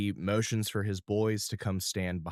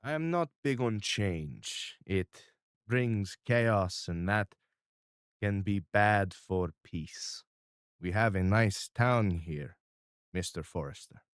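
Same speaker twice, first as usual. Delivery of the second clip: crime boss; 4 dB louder than usual. Acted. GM holds Mister R voice.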